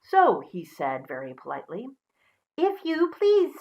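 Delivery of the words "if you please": much higher, slow piggy voice; high, slow pig politeness; polite little pig. Acted. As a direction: ask sweetly as a tiny pig.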